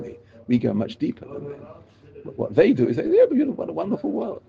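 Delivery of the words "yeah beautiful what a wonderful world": mock gushing. Mocking how others praise nature.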